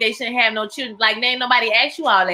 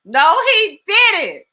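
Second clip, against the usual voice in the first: slow, high drawn-out no he didn't; reacting to the husband, drawn-out disbelief; outraged; 8 semitones higher, 4 dB louder, much slower than usual. Real, not acted. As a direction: stretch it out in disbelief. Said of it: "mock outrage, slow drawn".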